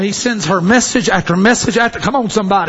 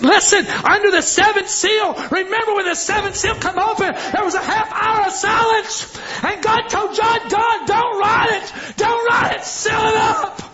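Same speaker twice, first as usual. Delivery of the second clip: high shouted preaching; full-force preaching; 10 semitones higher than usual. Acted. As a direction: cry out God's command with fire.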